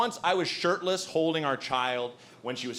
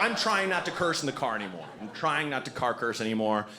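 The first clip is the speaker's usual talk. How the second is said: ordinary talk, nothing marked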